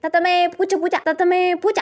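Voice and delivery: ordinary talk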